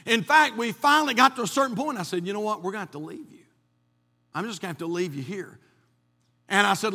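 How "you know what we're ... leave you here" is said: quiet, resigned. Retold or imitated. Telling his asthmatic wife to stay.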